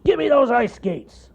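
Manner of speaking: loud cartoon bear demand. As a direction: bark the order. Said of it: "demanded the bear".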